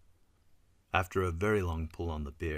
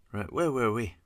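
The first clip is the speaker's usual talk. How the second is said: higher, louder prompting question; brisk prompt; 6 semitones higher, 6 dB louder than usual. A narrator's reading. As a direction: prompt the group to carry on. Regaining composure, prompts group.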